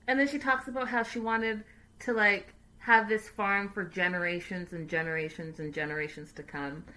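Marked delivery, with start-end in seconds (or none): none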